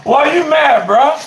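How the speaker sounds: high, loud jeering question; mocking taunt. Real, not acted. Streamer goading an angry blocked viewer, loud mocking taunt. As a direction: needle him in a loud, high voice.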